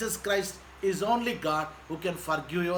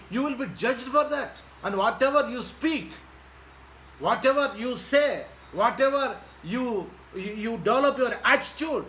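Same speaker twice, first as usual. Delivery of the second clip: forceful preaching; 3 semitones higher than usual. Acted. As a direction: preach it out to the crowd. Preaching to congregation.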